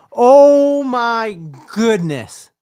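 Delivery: slow, high, loud oh my goodness; excited shout. Real, not acted. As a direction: stretch it out in disbelief. Reacting to a stunning touchdown.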